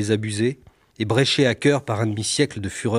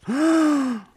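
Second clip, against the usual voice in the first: mock gasp. Reacting to crash photo.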